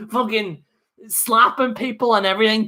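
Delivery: ordinary talk, nothing marked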